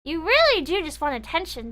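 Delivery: ordinary delivery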